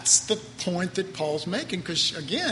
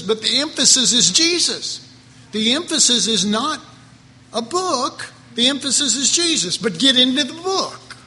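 louder, insistent preaching; emphatic preaching; 4 semitones higher, 5 dB louder than usual. Real, not acted. Preacher answers critics of his emphasis on Jesus, emphatic. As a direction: drive the point home, raised and forceful.

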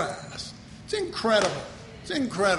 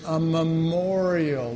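memorial spoken very slowly, each syllable weighed; slow down and land on memorial; emphasis on memorial; 2 semitones lower, much slower than usual. Shown as a demonstration. Pastor revealing the key word to the congregation, slow emphasis. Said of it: "and here it is".

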